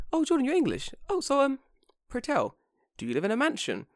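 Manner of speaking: high, dainty questioner voice; polite imitated inquirer. Acquaintance innocently asks Jordan about home, high polite imitation. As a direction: put on a harmless, curious lilt, high-pitched. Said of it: "She probably said it as like".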